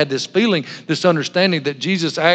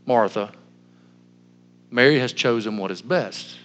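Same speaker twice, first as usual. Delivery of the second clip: low, soft and measured; calm, lowered voice, unhurried; 5 semitones lower, 5 dB quieter than usual. Acted. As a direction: gently settle someone down, with long pauses. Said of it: "And Jesus said, calmly".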